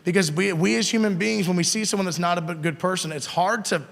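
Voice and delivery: normal talking voice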